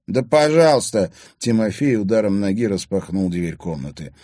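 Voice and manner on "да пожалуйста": loud, high, heated retort; defiant. A narrator's reading. Accused boy allowing the search, defiant. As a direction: throw it back defiantly.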